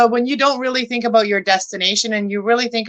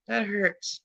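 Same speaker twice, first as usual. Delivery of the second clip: hushed, wincing repeat; a soft groaned echo of the hurt; quiet pained repeat; 12 dB quieter than usual. Real, not acted. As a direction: say it again under your breath in pain. Repeating the pain, much softer.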